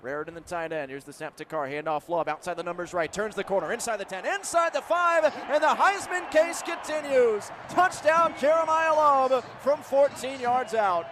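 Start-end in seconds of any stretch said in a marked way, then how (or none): 4.3-11.1 high, slow, stretched-out scoring call; excited touchdown call. Real, not acted. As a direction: announce the score in ringing tones.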